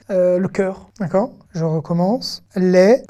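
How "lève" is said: "Lève" ending on a bare v sound; silent final E. Shown as a demonstration. Teacher showing students the silent final E, careful demo of "Lève". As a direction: say "Lève" with its final v set apart, no vowel after it. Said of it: "lire en le E muet de la fin".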